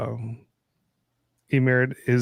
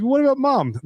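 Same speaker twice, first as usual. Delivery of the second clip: put-on child voice; 13 semitones higher than usual. Kid asking dad about mom, high child voice. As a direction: ask it like a scared child.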